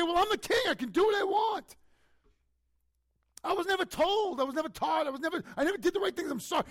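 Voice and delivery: high, loud, shouting excuses; defiant king excuses. Pretend. Preacher imagining David arguing back, loud protest. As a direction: whine and bluster like a cornered king. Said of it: "He doesn't sit there and say".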